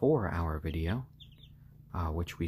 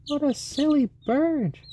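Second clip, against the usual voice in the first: much higher, louder sing-song pet voice; 6 dB louder than usual. Real, not acted. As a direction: coo up high at the bird. Cooing at pet chicken.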